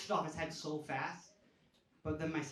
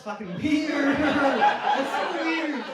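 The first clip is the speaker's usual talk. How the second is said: higher, louder, slow, laughing disbelief; incredulous laughing outburst; 9 semitones higher, 12 dB louder, much slower than usual. Real, not acted. Comic telling the crowd about fathers' reasoning, laughing outrage. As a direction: stretch it out in amused outrage.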